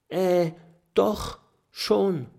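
scared dragon stammering to the tiger. A narrator's reading. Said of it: "stottert Kokosnuss". (ängstliches Stottern)

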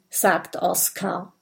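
plain reading voice

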